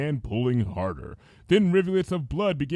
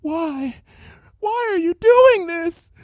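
tearful plea; 8 dB louder, slower than usual. Acted. Tearful Fluttershy pleading.

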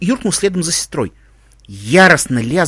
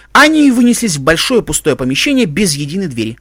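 ordinary delivery, nothing marked

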